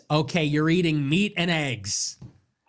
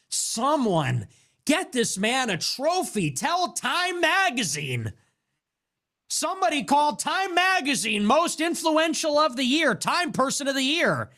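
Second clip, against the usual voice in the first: sarcastic praise; 8 semitones higher than usual. Pretend. Comic mocking the shopper with fake honours. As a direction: hail him like a hero, sneering.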